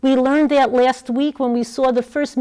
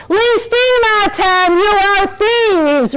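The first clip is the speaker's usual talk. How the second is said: loud, slow, high accusing cry of thieves; accusing shout; 11 semitones higher, 6 dB louder, slower than usual. Retold or imitated.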